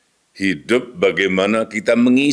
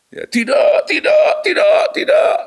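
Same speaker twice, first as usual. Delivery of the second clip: screaming refusal; 3 dB louder than usual. Retold or imitated. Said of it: "jerit-jerit".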